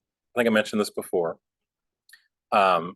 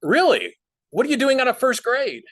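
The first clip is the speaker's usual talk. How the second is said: high, louder, mock incredulous; mom mocking the scammer; 7 dB louder than usual. Mom answering the fake grandson caller, mock incredulous. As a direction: act shocked and mocking. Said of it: "And she said".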